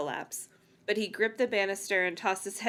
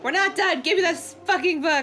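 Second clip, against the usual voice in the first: mock outrage; 8 semitones higher, 5 dB louder than usual. Grabbing the book back, mock outrage.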